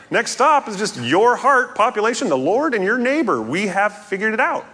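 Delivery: high-pitched, breezy announcement; hammed-up cheerful wrap-up; mock triumph. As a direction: declare victory as if it's all solved. Mock triumphant wrap-up before "Unless.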